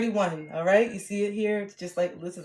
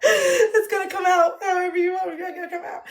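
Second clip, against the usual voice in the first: high-pitched words squeezed through real laughter; laughing; 11 semitones higher, 6 dB louder than usual. Real, not acted. Streamer saying she feels regulated now, laughing hard. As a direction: crack up while saying it.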